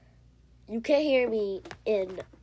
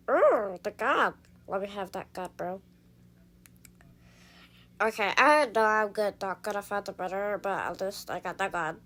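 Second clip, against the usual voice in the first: Stitch voice. Pretend. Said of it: "the Stitch voice".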